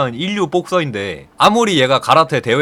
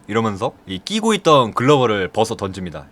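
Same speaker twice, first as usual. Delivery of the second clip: ordinary talk, nothing marked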